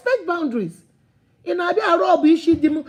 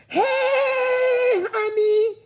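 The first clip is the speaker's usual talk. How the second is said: overfamiliar friend; 10 semitones higher than usual. Acted. Woman greeting your husband right in front of you, gushing and high. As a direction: greet someone's husband far too sweetly. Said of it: "They will come to your husband".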